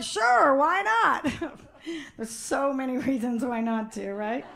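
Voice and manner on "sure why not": higher, upbeat casual yes; breezy agreement. Retold or imitated. Woman answering guys who ask, breezy agreement. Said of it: "And I'll be like".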